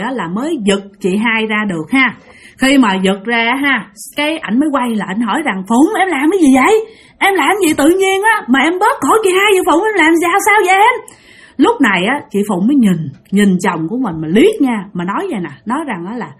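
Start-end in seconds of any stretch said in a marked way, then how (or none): 5.7-11.2 alarmed questioning. Retold or imitated. Husband finds wife strangling sister.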